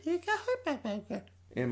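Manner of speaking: put-on high, drawn-out baby babble; baby sound. Host as an infant in the crib, baby sound. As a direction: stretch it into pretend infant noises. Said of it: "That's my baby sound".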